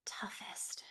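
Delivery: barely audible thinking aloud; pondering whisper. Real, not acted. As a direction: whisper it to yourself while searching for an answer. Pondering a hard question to herself.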